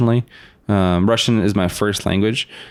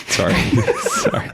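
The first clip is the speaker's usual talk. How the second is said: laughing apology. Real, not acted. Laughing apology mid story.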